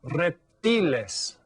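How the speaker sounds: "reptiles" the Spanish way, rep-TEE-les, slowly; Spanish pronunciation. Shown as a demonstration. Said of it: "That's how you spell it in Spanish".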